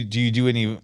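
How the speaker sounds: ordinary talk, nothing marked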